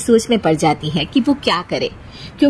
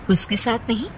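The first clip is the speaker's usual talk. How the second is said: ordinary delivery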